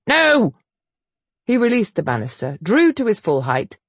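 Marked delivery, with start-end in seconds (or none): 0.1-0.5 sharp denial. Acted. Hero's angry denial.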